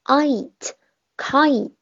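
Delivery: very slow kite with a long gliding I; slow careful kite; kite demo. Shown as a demonstration. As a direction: stretch the I glide in kite. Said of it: "第二个单词".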